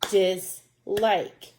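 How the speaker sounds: "Dislike" in two separate beats, DIS, LIKE; clapped-out syllables. Shown as a demonstration. Teacher clapping out a word with pupils, split "Dislike". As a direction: break "Dislike" evenly between its syllables. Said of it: "One more time".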